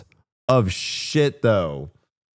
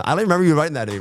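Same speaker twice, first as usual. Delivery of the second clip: usual speaking voice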